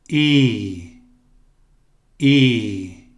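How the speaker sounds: "I" as a long, stretched ee. Shown as a demonstration. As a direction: hold the vowel long. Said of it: "É um longo I aqui". ("I" longo e esticado)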